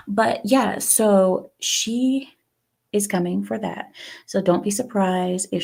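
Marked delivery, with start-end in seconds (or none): none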